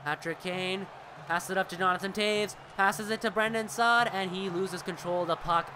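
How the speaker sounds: brisk sportscaster call, rising on the shots; live play-by-play. Acted. Streamer calling the hockey game for his viewers, lively play-by-play.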